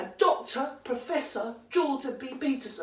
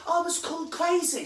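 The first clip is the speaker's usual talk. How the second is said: mock poem reading; 6 semitones higher, 4 dB louder than usual. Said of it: "this poem is like".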